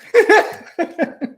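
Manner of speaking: very high, loud squealing laugh; shrieking laugh. Real, not acted. Laughs after self-censoring.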